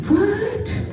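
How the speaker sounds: high, sing-song mock disbelief; mock shocked audience. Mocking the audience's surprise, high sing-song gasp. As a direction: play the gasping crowd, voice way up.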